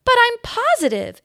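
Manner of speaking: high, loud protest; mother insists against laughter, high and loud; insistent. A narrator's reading. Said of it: "insisted the boy's mother".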